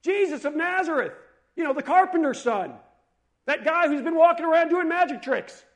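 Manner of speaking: high, loud mock yelling; shouting out. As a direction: holler it across a crowd. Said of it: "he began to shout out and say".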